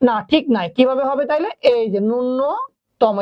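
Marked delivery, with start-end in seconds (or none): 2.1-3.2 ন্যূনতম stretched out very slowly; slow spelling demo. Shown as a demonstration. Teacher writing the correct ন্যূনতম for students, very slowly drawn out. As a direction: draw out ন্যূনতম while writing it. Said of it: "এরকম হবে".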